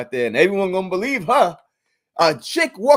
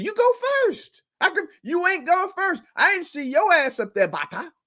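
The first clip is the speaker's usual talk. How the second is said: much higher, teasing taunt; mocking dare; 9 semitones higher than usual. Taunting Bata about the tree, high mocking voice. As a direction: needle her, sarcastic.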